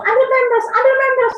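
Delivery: high, loud shout for help; panicked yell. Retold or imitated. Mother shouting to her son for pampers, panicked yell. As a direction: yell to wake the child, frantic. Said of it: "sambil teriak-teriak".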